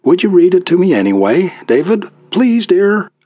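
high, pleading wife voice; smiling entreaty. A narrator's reading. Wife begs husband to read his poem, smiling entreaty. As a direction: coax him sweetly, rising at the end. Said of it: "smiling with entreaty".

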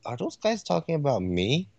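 softly voiced mock bewilderment; imagined listener, mildly baffled; puzzled. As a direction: wonder quietly, as if scratching your head. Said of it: "just scratching his head".

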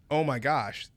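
ordinary talk, nothing marked

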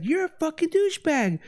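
very high mocking retort; mock angry woman. Imitating the neighbor lady firing back, high and mocking. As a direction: fire back like a squeaky parody.

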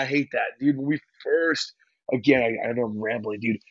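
ordinary talk

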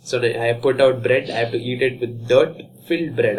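normal talking voice

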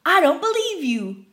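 a sour customer who won't believe you; suspicious customer. Retold or imitated. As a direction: sneer it back in disbelief.

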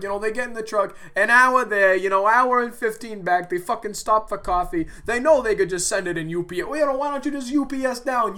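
imitated coworker rant. Coworker ranting about wasting time at work, loud and high. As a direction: gripe like the union coworker.

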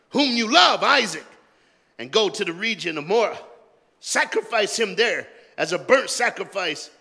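loud, high preacher's reading; full-force preaching. Acted. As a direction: preach God's command with fire.

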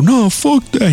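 high dismissive snap; mock angry coworker. Harry brushing off the excuse, high and dismissive. Harry refuses, throws pizza.

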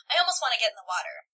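ordinary talk

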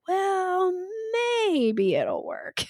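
high doubtful start, then slow and unsure; unsure hedge. Retold or imitated. Coach mocking a hesitant seller with a high, slow doubtful well. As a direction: sound like you doubt your own product. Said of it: "it sounds a little like".

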